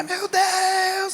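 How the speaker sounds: retold scream. Healed woman screaming in the bathroom, high piercing cry. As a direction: she shrieks it out from the bathroom. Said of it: "começou a gritar".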